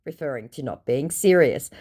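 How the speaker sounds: ordinary talk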